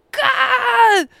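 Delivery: long, high shouted swear word; retold outburst. Overwhelmed at his birthday, crying out a curse. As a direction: stretch one curse into a loud wail.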